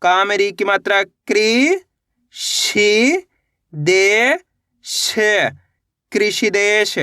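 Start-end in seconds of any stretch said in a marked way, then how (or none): 0.0-5.1 very slow, each syllable drawn apart. Shown as a demonstration. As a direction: spell it out slowly, piece by piece. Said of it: "पहले कृषि पढ़ो". (अक्षर-अक्षर धीरे-धीरे)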